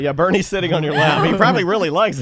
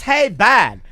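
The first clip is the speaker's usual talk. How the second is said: mock loud call; 2 semitones higher than usual. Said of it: "And he's yelling loud".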